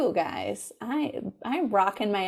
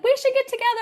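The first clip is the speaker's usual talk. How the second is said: mock eager fan; 4 dB louder, much faster than usual. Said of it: "People are like".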